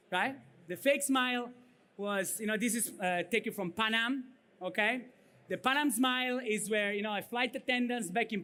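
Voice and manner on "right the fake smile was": ordinary talk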